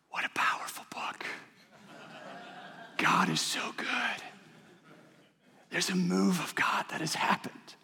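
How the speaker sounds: hushed, breathy awe at God's goodness; awed whisper. Pretend. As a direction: whisper it like you're moved to awe. Imagined awed reaction to book.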